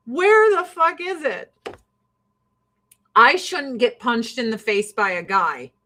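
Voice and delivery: ordinary delivery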